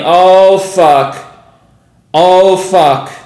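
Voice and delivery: loud, stunned swearing; real excited outburst, loud; amazed exclamation. Real, not acted. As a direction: burst out, overwhelmed by the spike. Reacting to the sudden spike.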